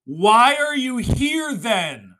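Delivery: loud, high, drawn-out demand; indignant, stretched-out shout; screaming at someone. Acted. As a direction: yell it slowly at an offender. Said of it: "screaming at you".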